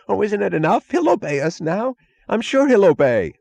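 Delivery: high, pleading voice; whining plea. A narrator's reading. Mooch pleads that Galt will obey now, high and whining. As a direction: plead to stop, on the edge of panic.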